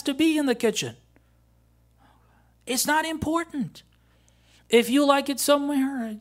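high, loud, exasperated sermon voice; impassioned preaching. Acted. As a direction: preach it emphatically, pitched right up. Preaches at full force.

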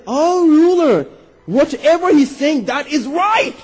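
high, ringing declaration to a ruler; emphatic proclamation. Retold or imitated. Acted Imam stepping in to proclaim.

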